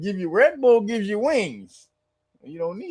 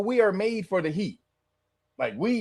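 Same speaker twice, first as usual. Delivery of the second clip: usual speaking voice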